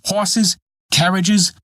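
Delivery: each word flung up high in scorn; mock the foolish wish; scornful. A narrator's reading. Dwarf to Peter, hammed-up scorn.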